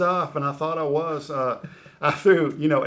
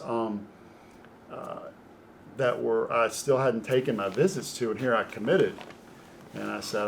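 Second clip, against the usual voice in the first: usual speaking voice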